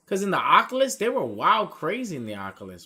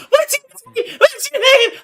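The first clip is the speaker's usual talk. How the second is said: very high, loud squeal; mock frantic screech; 12 dB louder than usual. Kids in the imposter game, high mock-panicked screech. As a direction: shriek it far above your own voice.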